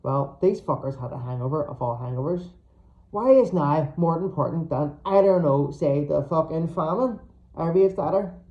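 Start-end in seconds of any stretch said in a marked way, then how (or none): none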